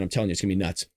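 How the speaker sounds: normal talking voice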